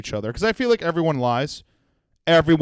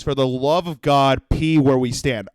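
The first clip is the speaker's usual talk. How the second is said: ordinary delivery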